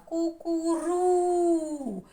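«Кукурудза» high, loud, with РУ held long; наголос співом. Shown as a demonstration. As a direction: sing out the stress in «Кукурудза» on РУ. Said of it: "Не дуже співаємо". (співучо, голосно, протягуючи РУ)

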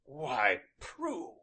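begin, then falter on her name; taken aback, anger suddenly gone; strangely altered voice. A narrator's reading. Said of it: "he began in a strangely altered voice".

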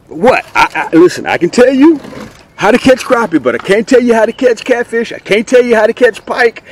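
ordinary talk, nothing marked

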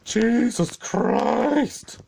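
loud, high, drawn-out outburst; exasperated cry. Real, not acted. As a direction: stretch it out in exasperation.

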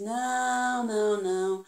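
lightly voiced farm animal; a hint of a cartoon animal; animal character voice. Animal answers in story. (voz de bicho)

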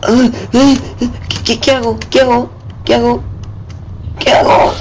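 high frantic panic; panicked. Real, not acted. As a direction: squeal it in a rush of panic. Panicking in game.

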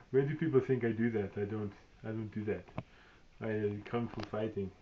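put-on thick Austrian Arnold accent throughout; Arnold impression. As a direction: play Arnold Schwarzenegger reviewing his own action figure. Speaker plays Arnold reviewing a toy.

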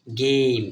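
গেম with a long ei vowel, said loudly; demonstrated pronunciation. Shown as a demonstration. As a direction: stretch গেম as geim, final e silent. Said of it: "এটা দেখেন গেম". (গেম জোরে, টেনে উচ্চারণ)

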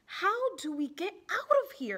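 nervous, high question; worried. Acted. Worried child trapped in volcano.

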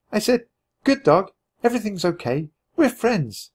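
put-on high, bright girl's voice; a young girl speaking up eagerly; Annie's voice. A narrator's reading.

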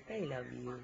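soft, low loving words; tender. Real, not acted. Mother tells baby love.

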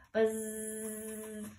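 long soft droning buzz; dryer buzz. A narrator's reading. Honeybee bouncing like a tumble dryer. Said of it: "Honeybee bounced up and down".

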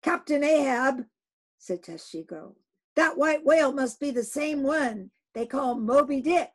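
loud, high eager call; name the whale with excitement; harpooner Tashtego. Acted. Tashtego tells the captain it is Moby Dick.

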